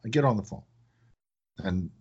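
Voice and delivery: normal talking voice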